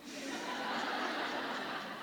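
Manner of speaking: soft airy laugh of pride; breathy laugh. Real, not acted. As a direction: let out a quiet, almost silent laugh.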